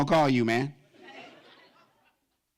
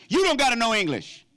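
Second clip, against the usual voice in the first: excited; 9 semitones higher, 4 dB louder than usual. Acted. Excited about Spanish TV.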